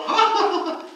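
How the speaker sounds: high, loud scornful cackle; mocking laughter. Mourners mocking Jesus, loud scornful laughter. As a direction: laugh him to scorn, hammed up. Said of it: "They're laughing".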